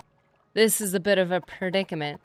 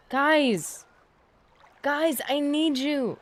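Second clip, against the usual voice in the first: higher, slow call for help; 5 semitones higher, much slower than usual. Real, not acted. Player calling his wandering followers, drawn-out higher call. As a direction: shout across the map for his followers.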